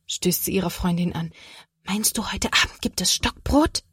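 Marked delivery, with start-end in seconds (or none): 1.8-3.8 eager stage whisper to a friend; hushed, curious child's question; excited whisper. A narrator's reading. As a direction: whisper the question conspiratorially, with some voice breaking through. Girl whispering to friend during story.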